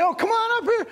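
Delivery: high, playful call to a little one; eager grown-up beckoning a child; inviting a child. Acted. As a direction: coax a small child up, pitched high. Adult calling child to lap.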